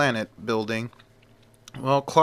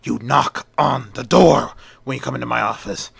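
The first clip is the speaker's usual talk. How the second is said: loud, exasperated telling-off; angry boss; 2 semitones higher, 5 dB louder than usual. Acted. Angry editor scolding Olsen for barging into his office, irritated shout.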